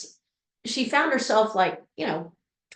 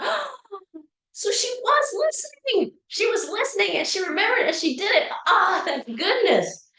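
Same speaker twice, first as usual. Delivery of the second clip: much higher, delighted inner voice; delighted realisation; 10 semitones higher than usual. Retold or imitated. Mother realising her daughter listened, delighted and relieved.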